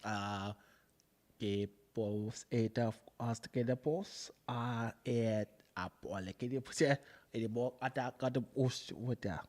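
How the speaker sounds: low, soft fake Spanish in an African-like accent; halting, hushed gibberish in an African-tinged accent; botched African-sounding accent. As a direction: mumble mock Spanish with a wandering African accent. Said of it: "it was African".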